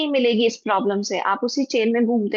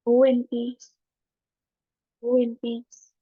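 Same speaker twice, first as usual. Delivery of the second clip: guided meditation. Acted. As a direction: guide the class gently into forgiveness. Leads a forgiveness meditation.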